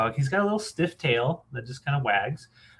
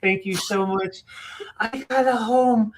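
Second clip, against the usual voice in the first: high, squeaky happy pup; grateful dog voice; 9 semitones higher, slower than usual. Pretend. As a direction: speak as the dog, thrilled to be adopted. Dog thanking new owner.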